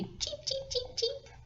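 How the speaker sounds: high tiny chick peeps; chick cheeping. Acted. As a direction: peep like a baby chick. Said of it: "is going to say".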